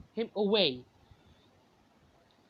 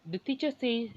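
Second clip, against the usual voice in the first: plain reading voice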